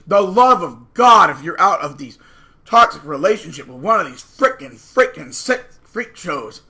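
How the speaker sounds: a loud, slow, fed-up plea to get out; exasperated outburst. Real, not acted. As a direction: drag the words out in exasperation.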